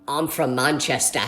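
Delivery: low, slow put-on British accent; fake British accent. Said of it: "I kept pretending to be British".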